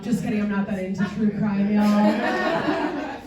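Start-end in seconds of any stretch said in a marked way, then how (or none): none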